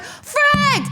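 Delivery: very high squealed curse; mock panic. Traveler seeing how far gates are, high mock panic. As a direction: squeal it in comic frustration. Retold frustration at distant gates.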